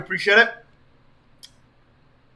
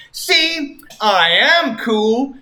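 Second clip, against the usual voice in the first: cocky Sonic; 6 semitones higher, much slower than usual. Acted. Sonic shows off.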